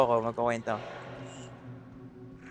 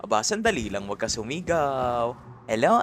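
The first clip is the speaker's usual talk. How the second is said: ordinary talk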